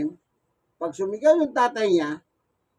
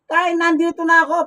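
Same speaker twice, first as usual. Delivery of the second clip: higher, louder call to father; 6 semitones higher, 7 dB louder than usual. Retold or imitated. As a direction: announce you're here to father. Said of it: "sumisigaw pa".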